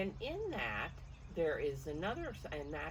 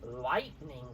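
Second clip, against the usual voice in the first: louder, slower storm lines; dramatic storybook reading; 4 dB louder, slower than usual. A narrator's reading. Grandmother reading storm rhyme to children, louder and slower. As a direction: read it like a storm rolling in.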